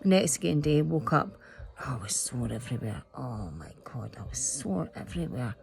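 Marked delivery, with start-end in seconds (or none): 1.8-5.5 low, hushed whisper of a sore body. Retold or imitated. To viewers about waking up sore, a hushed whisper. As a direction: breathe it out like every muscle aches.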